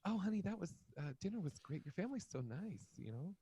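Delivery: soft, hushed put-on girlfriend voice; girlfriend role. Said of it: "I'm the girl, all right?".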